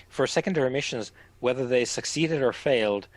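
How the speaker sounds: usual speaking voice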